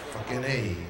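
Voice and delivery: normal talking voice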